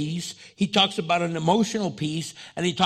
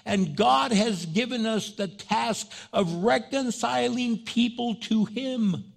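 scripture reading; 4 semitones higher, slower than usual. Acted. Reads scripture passage aloud.

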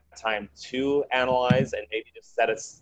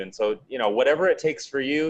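usual speaking voice

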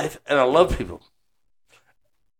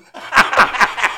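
burst of laughter; 5 semitones higher, 5 dB louder than usual. Real, not acted. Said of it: "laughs at own story".

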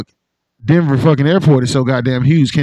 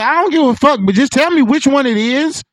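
much higher, pleading exasperation; mock demand for answers; exasperated squeal; 11 semitones higher than usual. Telling conspiracy talkers to pick one theory.

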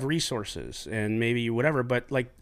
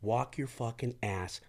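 quiet menace; 3 semitones lower, 6 dB quieter than usual. Retold or imitated. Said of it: "And I said".

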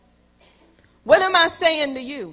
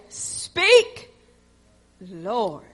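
raised call, then drop reverently low; solemn reply; 7 semitones lower than usual. Acted. Eli teaching Samuel his answer, solemn and spaced out. Voicing Eli's instructed reply.

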